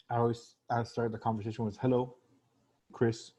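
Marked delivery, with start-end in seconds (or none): 1.8-3.3 "Hello," then a beat before "Chris"; unhurried "Hello, Chris" with a pause; pause after greeting. Shown as a demonstration. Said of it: "do, like, a pause".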